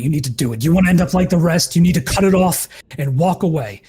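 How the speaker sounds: rising to a frantic yell; desperate shout. Acted. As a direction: build it up into a shout. Handler's plea builds to shout.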